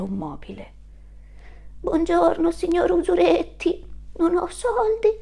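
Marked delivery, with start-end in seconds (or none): 1.8-5.1 higher, pleading voice; mother goat placating the landlord; timid mother goat. Acted. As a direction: beg for time nervously. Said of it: "disse mamma Capra".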